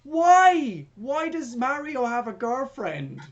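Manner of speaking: high, loud exasperated outburst, then drawn out. Real, not acted. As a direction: cry out in comic disbelief. Ranting about a pointless plot choice.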